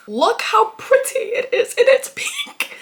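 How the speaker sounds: high, slow, giddy gushing; squealing with delight. Real, not acted. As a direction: swoon over how pretty it is. Gushing over pink book.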